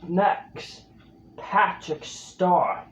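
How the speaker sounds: ordinary delivery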